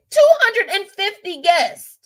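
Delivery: "Two hundred and fifty guests" high and stretched out; incredulous stress. Real, not acted. Host makes viewers hear the number, slow high disbelief. Repeats count after "Do y'all hear?.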